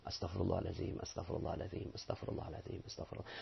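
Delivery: fading into a breathy whisper; whispered istighfar. Shown as a demonstration. Preacher demonstrates the correct istighfar, fading whisper. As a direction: pray it softly until it vanishes. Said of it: "pengucapan istighfar menjadi benar".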